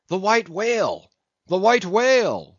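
high, loud cry of the whalemen; frenzied cry. A narrator's reading. Captain, mates and harpooneers crying out at the whale, high and loud. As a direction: shout it out, hungry for the chase. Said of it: "was the cry from the captain".

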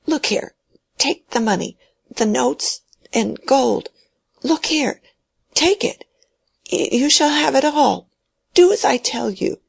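a dying man pushing money at her, rising to a cracked high pitch; frantic old man. Acted. Dying old man begging Mary to take his money, high and frantic. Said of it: "he began to say hurriedly".

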